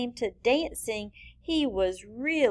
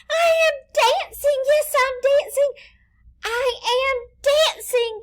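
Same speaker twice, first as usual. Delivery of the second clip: much higher, louder cry of delight; joyful cry; 7 dB louder than usual. Acted. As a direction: shout it out with pure joy. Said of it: "Gerald cried".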